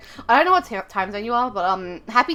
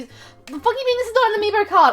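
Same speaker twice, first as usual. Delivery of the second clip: higher-pitched, incredulous protest; indignant disbelief; 8 semitones higher than usual. Real, not acted. Streamer whose amiibo card gets rejected, indignant disbelief. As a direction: challenge it in disbelief.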